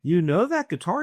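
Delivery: host thrilled that the guest knows the guitar; surprised delight. Retold or imitated. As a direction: react as if you found a kindred spirit.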